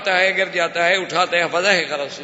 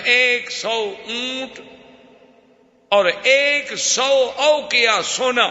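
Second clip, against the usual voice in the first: drawn out, pitched higher, each word weighed; slow and high, with great ceremony; slow solemn decree; 6 semitones higher, much slower than usual. Acted. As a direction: announce it slowly like a grand royal gift. Prophet decrees a royal gift.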